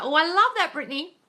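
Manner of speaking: high, gushing squeal of delight. Acted. Host gushing over a viewer's happy news, high and delighted. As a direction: gush over the good news like a thrilled friend.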